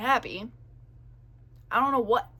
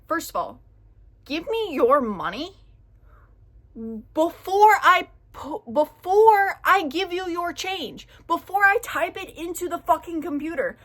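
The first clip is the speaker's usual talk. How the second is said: aggressive rant; 5 semitones higher, 6 dB louder than usual. Real, not acted. Ranting at the rude customer, loud and furious. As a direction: vent the fury at her. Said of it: "Sorry, I'm getting really fucking aggressive".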